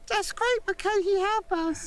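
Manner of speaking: very high, squeaky British attempt; exaggerated high-pitched British plea; British accent. Shown as a demonstration. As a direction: push the put-on British way up high. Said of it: "am I going more British".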